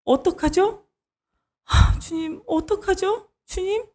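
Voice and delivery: high, pleading whimper to God; whining prayer. Acted. Worshipper pleading with an unanswering Lord, high whimper. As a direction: beg like a hurt child who gets no answer. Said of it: "이런 마음".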